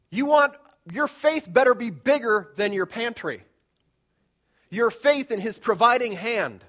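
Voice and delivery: loud and slow, each word weighted; slow emphatic preaching. Acted. Pastor urging congregation on faith, slow loud emphasis. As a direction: lean hard on every word.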